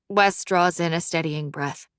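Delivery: ordinary delivery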